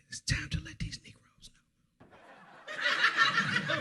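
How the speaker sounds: hushed menacing whisper. Retold or imitated. Sister whispering a warning to her brother in the car. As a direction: whisper it like a dark secret. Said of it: "she looks at me and says".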